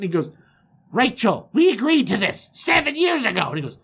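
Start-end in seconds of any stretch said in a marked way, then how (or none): 0.9-3.4 higher, exasperated complaint; indignant protest. Acted. Groom reminding uncle of their deal, indignant. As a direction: insist on the broken deal.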